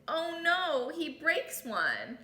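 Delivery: usual speaking voice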